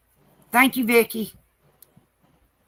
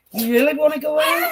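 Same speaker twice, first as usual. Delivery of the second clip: loud, high, excited question to dogs; riling up dogs; 6 semitones higher, 5 dB louder than usual. Real, not acted. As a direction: whip the dogs into a frenzy.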